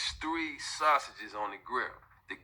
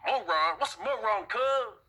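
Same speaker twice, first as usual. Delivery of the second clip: "Moron?" asked rising and higher, confused street voice; puzzled gangbanger; 8 semitones higher, slower than usual. Retold or imitated. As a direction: repeat "moron" back like you never heard it.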